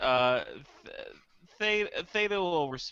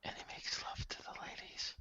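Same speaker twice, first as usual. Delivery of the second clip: whisper; 17 dB quieter than usual. Real, not acted. Whispered aside about Isaac.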